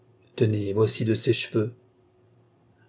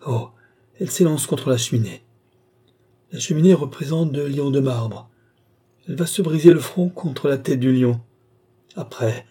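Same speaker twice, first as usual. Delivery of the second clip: ordinary delivery, nothing marked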